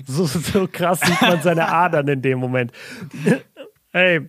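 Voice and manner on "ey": choking on a drink. Real, not acted. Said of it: "ich hab mich gerade verschluckt".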